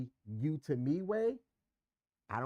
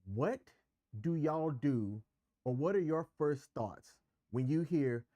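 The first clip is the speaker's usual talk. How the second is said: normal talking voice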